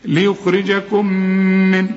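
slow ليخرجكم من, the two ميم merged with a nasal hold; slow recited idgham; merged mim recitation. Shown as a demonstration. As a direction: join the ميم into one held nasal. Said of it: "بتضغم في الميم الثانية".

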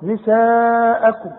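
نساءكم with the aa held very long and slow; obligatory madd. Shown as a demonstration. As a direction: stretch نساءكم for four counts. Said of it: "لازم عند الوصل وعند الوقت". (مد نساءكم أربع حركات)

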